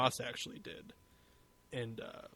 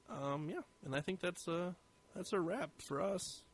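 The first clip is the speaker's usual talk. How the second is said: slightly hoarse, losing voice; 4 semitones higher, 8 dB quieter than usual. Real, not acted. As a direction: voice wearing out at the end. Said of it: "I'm losing my voice a little".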